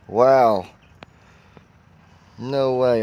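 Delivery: ordinary talk, nothing marked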